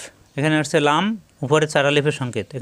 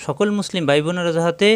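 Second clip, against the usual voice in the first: ordinary talk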